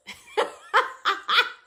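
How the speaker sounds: sudden high laugh; high burst of laughter. Real, not acted. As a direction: crack up at the joke. Husband's comment cracks her up.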